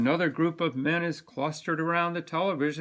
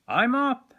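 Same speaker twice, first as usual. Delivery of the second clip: much higher, louder eager burst; boy bursting with excitement; excited; 10 semitones higher, 7 dB louder than usual. Acted. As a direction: jump out of bed with it. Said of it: "I say excitedly".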